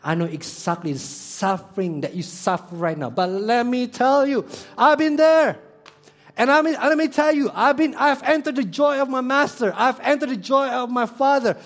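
Jesus reassuring, building. Retold or imitated. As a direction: grow from gentle comfort into triumphant preaching. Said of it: "Jesus say".

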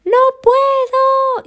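very high, slow, loud wail; terrified scream. Acted. Koala screaming as the tree gives way: long high wail. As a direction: stretch out a panicked scream. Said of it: "Y grita".

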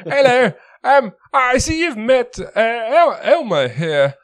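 high-pitched, hammed-up put-on accent; Sildar's accent. Game master voicing Sildar to the party, high hammed-up accent. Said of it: "now for that accent again".

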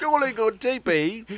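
slow, lightly put-on British accent; a hint of a posh British accent, slow; slow mock British accent. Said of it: "her accent".

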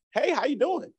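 high-pitched friendly hello; chirpy greeting. Retold or imitated. Student greeting a nice professor, chirpy high voice. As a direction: imitate an eager, sugary greeting.

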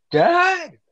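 put-on high-pitched drawn-out kid's call; mock calling out. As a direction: imitate a kid crying out for Dad. Imitating kid calling out for dad.

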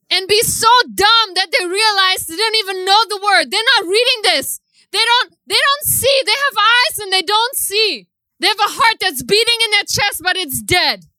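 high, heated preaching rant; raging rant. Real, not acted. Ranting at Christians who won't preach, heated. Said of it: "I'm raging on the inside".

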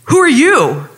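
loud, high mock yell; retold yell. Police officer challenging a stranger, yelled back. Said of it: "the guy yells back".

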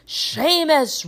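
high roared call; Professor Lupin. A narrator's reading. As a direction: roar the pupil's name as a summons. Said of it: "roared Professor Lupin".

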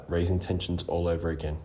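usual speaking voice

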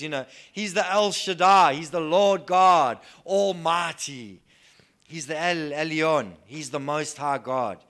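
loud proclaiming preacher, names of God ringing out; rousing sermon declaration, loud and stately; full-force preaching. Acted.

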